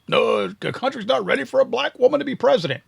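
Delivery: sarcastic imitation of a losing candidate; mocking quote. Mocking Kamala Harris's excuse.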